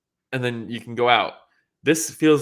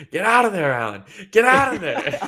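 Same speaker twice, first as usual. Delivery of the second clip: high, loud imitation of a commentator yelling; mock yell; 9 semitones higher, 5 dB louder than usual. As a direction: yell it like a ringside commentator begging the fighter. Joe yelling at the fighter.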